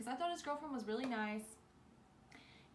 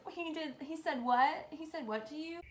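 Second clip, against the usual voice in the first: friends' shock; 5 semitones higher, 5 dB louder than usual. Retold or imitated. Friends reacting shocked to story.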